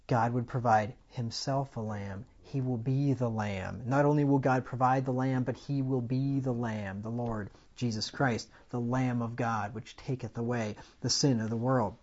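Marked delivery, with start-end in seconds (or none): none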